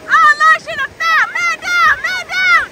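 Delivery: high-pitched laughing cry that someone fell; high amused alarm. Real, not acted. Child falls in the waves.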